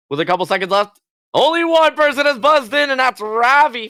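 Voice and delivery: high, rising game-show hype; excited announcing. Real, not acted. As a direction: build the tension like a host. Host calls the buzz tension.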